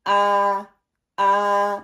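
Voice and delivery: آ from the bottom of the throat; hamza sound. Shown as a demonstration. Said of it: "حمزہ کو ہم کیسے پڑھیں".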